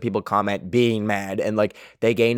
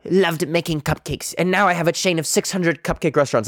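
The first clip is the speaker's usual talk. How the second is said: mock success-story testimonial, a little higher; success-story founder; 3 semitones higher than usual. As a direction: lightly put on a proud small-business founder. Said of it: "there's, like, success stories".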